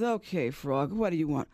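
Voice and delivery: low, unimpressed fox voice; Brother Fox. Acted. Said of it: "The fox looks out and says".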